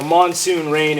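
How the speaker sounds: ordinary delivery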